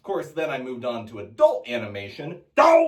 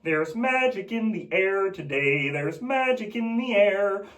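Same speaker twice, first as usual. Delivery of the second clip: high, reedy frog voice; cheery puppet frog; Kermit the Frog; 7 semitones higher than usual. Retold or imitated. Frog singing about magic, high and bright. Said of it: "like Kermit the Frog".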